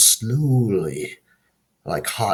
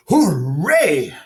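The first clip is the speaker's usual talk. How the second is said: higher, loud crow cheer; shout; 6 semitones higher, 6 dB louder than usual. Acted. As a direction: cheer out with joy. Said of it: "shouted the crow".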